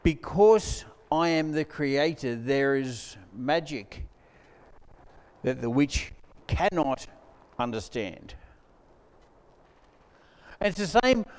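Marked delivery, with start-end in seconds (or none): 0.0-8.3 Aslan voice. Retold or imitated. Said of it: "And Aslan goes".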